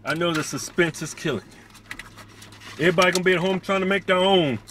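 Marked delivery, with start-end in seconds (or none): 0.0-2.9 mock suspense. Mock teasing to viewers.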